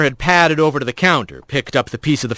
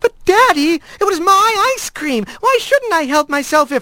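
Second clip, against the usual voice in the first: much higher, whining plea; whiny protest. A narrator's reading. Daughter protesting to her father, high and whiny. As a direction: whine to get out of trouble.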